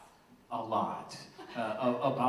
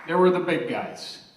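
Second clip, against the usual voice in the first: loud, proud toast to the room; rally the room with pride; father of the bride speech; 4 semitones higher, 7 dB louder than usual. Real, not acted. Toasting family members at the wedding.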